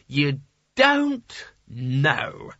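very slow, drawn-out rebuke; repeated snap. A narrator's reading. Sconner repeats himself over the wizard's protest, emphatic. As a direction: drag out DON'T and KNOW, falling at the end. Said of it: "repeated Sconner".

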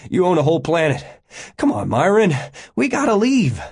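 high, loud and insistent; urgent pleading. A narrator's reading. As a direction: push harder, rising to near shout. Begging for passage off planet.

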